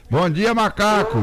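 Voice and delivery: high morning hail; calling out. Real, not acted. Host hails a listener on air.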